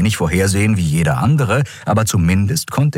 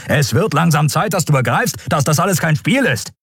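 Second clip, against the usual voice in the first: high-pitched, insistent lecture; stern urging; 10 semitones higher than usual. A narrator's reading. Admiral urging the prisoner to change course, stern. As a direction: press them to take this seriously.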